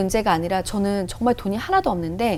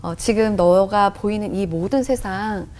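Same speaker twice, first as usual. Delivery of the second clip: slow, lightly acted patient questioner; Holy Spirit; slower than usual. The Spirit asks who made the world, slow calm voice. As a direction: take it slowly and calmly, like a patient teacher. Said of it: "그랬더니 성령님께서".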